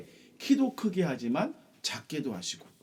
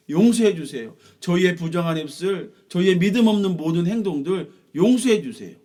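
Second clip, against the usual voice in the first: earnest plea; 5 semitones higher, 7 dB louder than usual. Acted. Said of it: "진심으로 하나님께 기도했어요".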